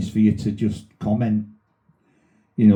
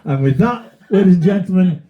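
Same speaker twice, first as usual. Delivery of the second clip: announcer sign-off; 7 semitones higher, 5 dB louder than usual. Acted. Host signing off the podcast, grand announcer voice. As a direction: sign off like a showman.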